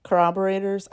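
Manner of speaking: ordinary delivery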